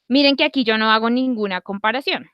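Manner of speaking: ordinary talk, nothing marked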